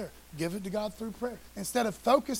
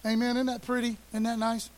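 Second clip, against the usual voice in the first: ordinary delivery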